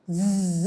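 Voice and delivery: ز as a soft sustained buzz through the teeth; buzzing z sound. Shown as a demonstration. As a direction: buzz ز between clenched teeth like a passing plane. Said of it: "ایسی آواز آپ کے دانتوں سے آنی چاہیے".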